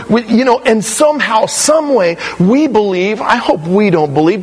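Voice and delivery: ordinary delivery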